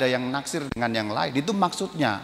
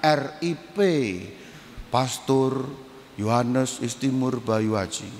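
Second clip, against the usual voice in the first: mock obituary announcement; 2 semitones lower, slower than usual. Mock death announcement of himself. (pengumuman duka pura-pura, rendah dan lambat)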